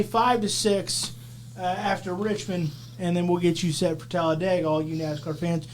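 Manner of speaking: normal talking voice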